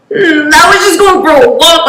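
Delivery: high, mildly whiny mock crying; acted teary excuse; imitated tearful plea. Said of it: "Like, I'm in tears".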